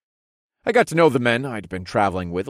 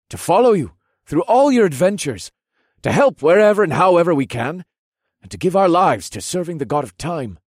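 higher, louder rallying pledge; loyal young lieutenant; 10 semitones higher, 6 dB louder than usual. Acted. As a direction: declare it like a toast. Lieutenant pledges loyalty with raised mugs.

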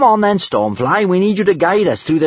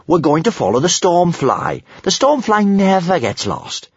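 high-pitched, self-assured boast; confident; 9 semitones higher, 4 dB louder than usual. A narrator's reading. Kamikaze trusts Stormfly to guide them, confidently. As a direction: declare it with total faith. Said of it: "more confidently this time".

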